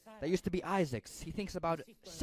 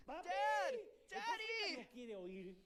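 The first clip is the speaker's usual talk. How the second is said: put-on much higher, a child's cry of "Dad!"; boy calling out; 12 semitones higher, 10 dB quieter, much slower than usual. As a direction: call to your father as a frightened boy. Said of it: "while the boy says".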